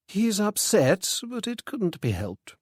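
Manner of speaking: high, slow resigned woman; a hint of a weary widow; lightly voiced lady. A narrator's reading. Widow answers Freddy about her son, resigned.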